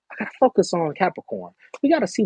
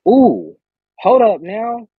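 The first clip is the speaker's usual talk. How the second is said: surprised ooh; 8 semitones higher, 7 dB louder, much slower than usual. Real, not acted. Surprised at a card.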